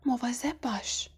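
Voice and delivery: soft, hushed «مواظب باش»; quiet warning. A narrator's reading. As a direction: murmur a warning under the breath. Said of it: "و آهسته گفت". (آهسته هشدار دادن)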